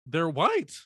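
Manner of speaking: a mock startled gasp rising high; mild mock surprise; surprised reaction. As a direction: play it as a sudden comic realization. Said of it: "my initial reaction was".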